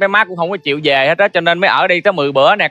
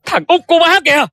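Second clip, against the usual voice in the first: high, urgent cry; agitated exclamation; 11 semitones higher than usual. Acted. Son revealing youngest child to mother, high urgent cry. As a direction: call it out at raised pitch.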